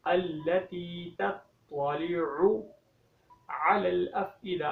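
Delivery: अल्लती तत्तलिउ with a true tau and ain, very slow; correct tajweed. Shown as a demonstration. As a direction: recite it correctly, slowly. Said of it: "तौ की जगह पे तौ की sound निकालनी है". (सही तौ और आइन के साथ धीमी तिलावत)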